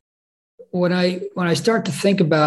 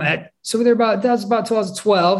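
ordinary talk

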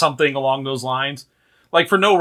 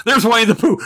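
laughing; 9 semitones higher, 3 dB louder, faster than usual. Real, not acted. Laughing at imagined Pooh sighting.

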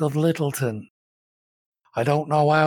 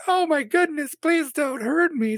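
feigned helplessness; 12 semitones higher than usual. Acted. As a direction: fake being helpless and frightened. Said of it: "feigned helplessness".

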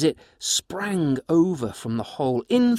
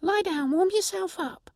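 much higher little creature voice, coaxing; gentle pixie. Acted. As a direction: settle the dog like a tiny host. Pixie soothes the dog.

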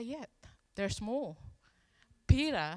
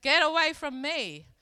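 loud, higher-pitched retold rebuke; 5 semitones higher, 10 dB louder than usual. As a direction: act out rebuking someone, loudly, falling at the end. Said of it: "Jesus rebuked him".

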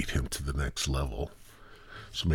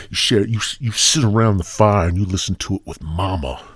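ordinary talk

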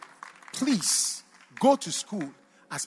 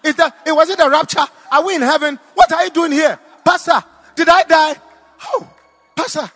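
shrill panicked shouting, much higher and louder; panicked scream; 9 semitones higher, 16 dB louder than usual. Retold or imitated. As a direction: shriek in panic as if waking in heaven. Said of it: "She screamed".

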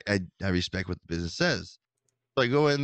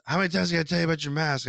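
higher, hurried imitation of nagging; quick, pitched-up telling-off; mock scolding; 8 semitones higher, faster than usual. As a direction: imitate a fed-up staffer telling someone off. Acts out scolding gym employee.

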